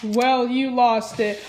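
normal talking voice

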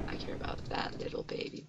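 fast unvoiced whisper. Real, not acted. Gamer fussing over a baby, in a whisper.

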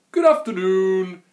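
loud, high sing-out greeting; smiling postman. A narrator's reading. Postman greets the Grunt, high and smiling. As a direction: call it out high with a big smile. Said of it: "When the postman smiled".

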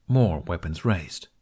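ordinary delivery, nothing marked

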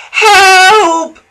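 high, loud panicked cry; terrified cry for help. Acted. Percy racing out of control, crying for help. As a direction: scream for help as you crash. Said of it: "Percy cried".

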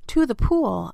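high, excited echoing call; yell. A narrator's reading. Boy echoes the firemen, yelling excitedly. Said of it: "I yelled".

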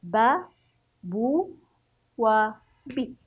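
با بو بي chanted clearly; syllable drill. Shown as a demonstration. Teacher repeating the vowel drill for young pupils. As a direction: call out each syllable. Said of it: "بنفس الطريقة".

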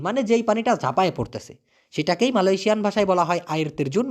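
ordinary talk, nothing marked

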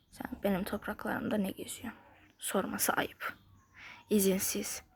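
hushed menacing whisper at a rival. Pretend. Gamer whispering threats at a rival snake. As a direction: breathe it as a quiet threat.

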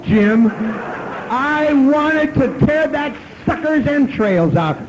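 high, loud, slow snarl through a laugh; Hulk-like rage. Retold or imitated. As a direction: roar it out like the Hulk. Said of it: "I'm Lou Ferrigno going for Hulk".

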